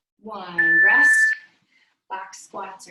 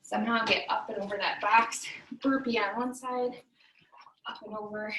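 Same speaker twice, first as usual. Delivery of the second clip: ordinary talk, nothing marked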